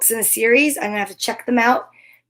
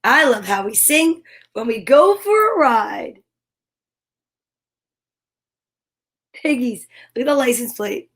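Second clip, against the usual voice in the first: gentle sing-song picture-book reading; reading to children. Acted. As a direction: read it warmly to small kids.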